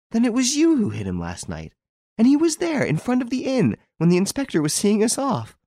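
piecing it together in amazement; excited realization. A narrator's reading. Hortense realizes the whole trick.

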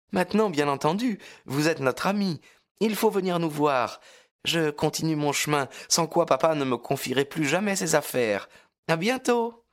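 put-on high, quick cheerful girl; young girl voice. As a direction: chirp a bright goodbye. Lucie cheerful farewell. (voix de jeune fille joyeuse)

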